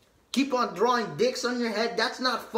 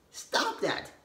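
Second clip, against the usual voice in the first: ordinary talk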